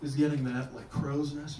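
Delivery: usual speaking voice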